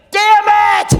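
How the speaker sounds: high loud curse; frustrated outburst. Retold or imitated. Sharp loud cry of frustration. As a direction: caught out, he curses loudly.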